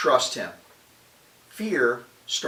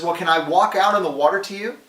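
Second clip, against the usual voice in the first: lightly acted eager question, higher and louder; Peter calling out; 4 semitones higher, 5 dB louder than usual. Peter asking Jesus from the boat, lightly acted calling out. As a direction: call across the water, mildly hammed-up. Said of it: "He says".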